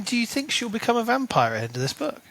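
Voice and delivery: normal talking voice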